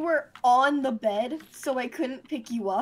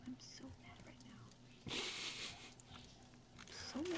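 breathy angry whisper; 25 dB quieter, much faster than usual. Real, not acted. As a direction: hiss it under your breath. Angry player muttering to himself.

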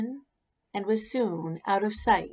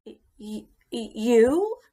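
stammering surprise; 3 semitones higher, 6 dB louder than usual. Acted. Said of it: "The caterpillar was so surprised".

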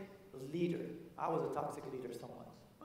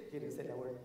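usual speaking voice

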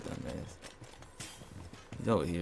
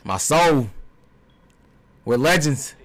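loud wail; 4 semitones higher, 4 dB louder, slower than usual. Real, not acted. Chanting after losing.

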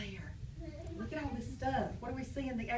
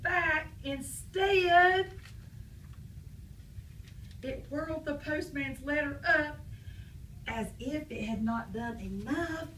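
put-on reading to children; 3 semitones higher than usual. Reading story aloud.

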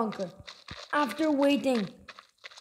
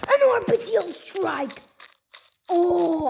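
excited; 7 semitones higher, 4 dB louder, slower than usual. Real, not acted. Thrilled by rare drop.

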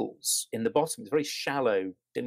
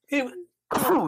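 about to sneeze, catching the breath; sneezing; 5 dB louder than usual. Real, not acted. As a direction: sneeze breaking into the words. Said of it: "I'm gonna sneeze in a minute".

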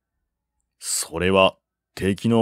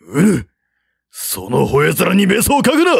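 enraged snarl; 6 semitones higher than usual. A narrator's reading. General answering the taunt, enraged shout. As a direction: snarl back in rage.

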